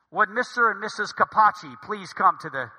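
loud airline paging call; lightly voiced public announcement, projected; gate announcement. Gate agent paging the couple to the desk, loud announcement.

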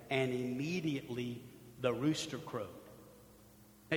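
ordinary delivery

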